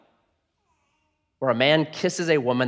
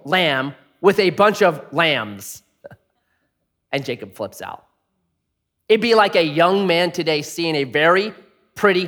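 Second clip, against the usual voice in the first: normal talking voice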